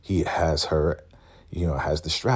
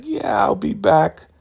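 very high, slow, louder mock voice; slow sly sweetness; 5 dB louder than usual. Marva leaving slowly, high sly. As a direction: draw it out slyly. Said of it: "She's like".

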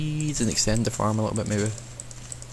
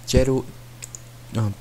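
ordinary delivery

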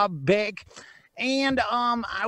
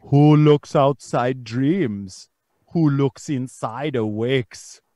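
put-on slow, measured accented recital; declaimed quiz quote; 6 semitones lower, 5 dB louder, slower than usual. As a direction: intone the riddle slowly, word by word.

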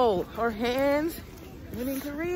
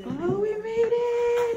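much higher, slow, drawn out; excited relief; 10 semitones higher, slower than usual. Real, not acted. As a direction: stretch it out in happy relief. Arrival, repeated higher.

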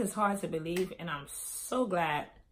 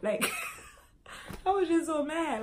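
laughing; 9 semitones higher than usual. Real, not acted. Storyteller looks back on being so mad, laughing. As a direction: laugh breaking into higher-pitched words. Laughs after acting out her rage.

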